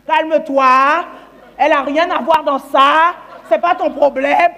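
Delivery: loud, drawn-out indignant shout; indignant defence. Retold or imitated. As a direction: shout him down, stretching each phrase. Acted man defending the other woman.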